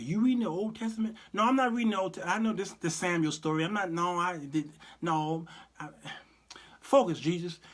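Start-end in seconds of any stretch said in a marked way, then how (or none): none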